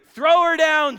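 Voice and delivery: high, loud shouted command; forceful commanding yell; acted yell. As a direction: yell the order up to the window. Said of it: "Jehu yelled".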